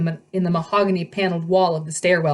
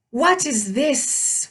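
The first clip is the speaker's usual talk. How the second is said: high, drawn-out monster question; snake-woman voice; 6 semitones higher, much slower than usual. A narrator's reading. Snake woman finds an intruder in her path, slow high creature voice. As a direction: stretch it out slowly like a snake creature.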